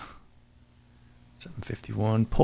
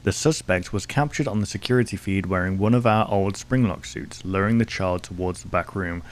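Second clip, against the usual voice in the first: measured, lower report reading; document read; 4 semitones lower, 4 dB quieter than usual. Acted. Streamer reading a case file to viewers, measured and lower. Reads in-game police report.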